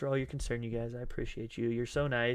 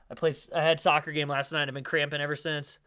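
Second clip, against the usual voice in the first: ordinary talk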